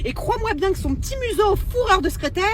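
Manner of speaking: ordinary delivery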